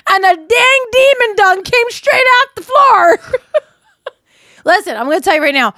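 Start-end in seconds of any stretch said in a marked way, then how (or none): 0.1-3.2 slow, laughing mock yell; excited shout. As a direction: shout it out like a campfire story. Hammed-up Ouija board story.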